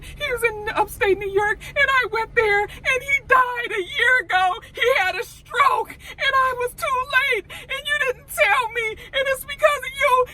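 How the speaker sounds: tearful screaming accusation at her mentor; crying. Retold or imitated. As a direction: wail it out through tears, voice up high. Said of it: "she's crying".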